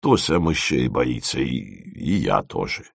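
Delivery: low, soft, halting confession; sheepish admission. A narrator's reading. Dima admits fear of mice to Timofeev, sheepish. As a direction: stumble slowly into admitting it.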